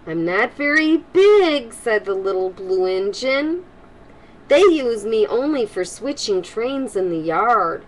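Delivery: lively storybook read-aloud voice; reading to children. Acted. Grandma reading a storybook to kids, lively and warm. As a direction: read it brightly to the little ones.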